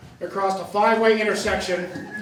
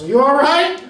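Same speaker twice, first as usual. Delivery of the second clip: driver calling out; 7 semitones higher, 7 dB louder than usual. Retold or imitated. Driver calls from truck window.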